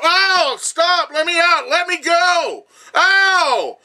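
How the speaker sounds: high-pitched, loud pained screaming; imitating her screams. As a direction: scream like a woman being hurt and trapped. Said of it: "there's this screaming, right, of Katana".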